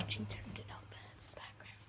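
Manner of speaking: hushed sneaking whisper. Real, not acted. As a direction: creep along, whispering. Sneaking down quietly.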